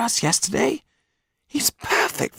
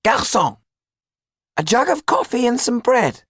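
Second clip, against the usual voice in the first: high-pitched call to the waiter; shouting; 4 dB louder than usual. A narrator's reading. Tetu shouts his order to the waiter, high shout. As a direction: call out across the cafe, very high. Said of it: "shouted Tetu".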